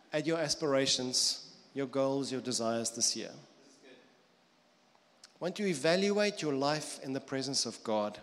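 ordinary delivery

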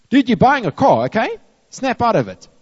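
high, loud mock scolding; exasperated retort. Preacher answering a whining friend, exasperated and loud.

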